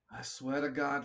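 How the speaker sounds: ordinary talk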